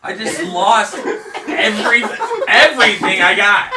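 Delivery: loud, high laughing complaint. Real, not acted. Player laughing about losing everything, loud and high. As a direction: laugh through the disbelief.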